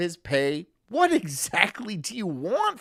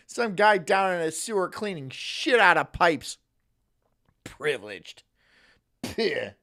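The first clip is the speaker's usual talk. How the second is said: normal talking voice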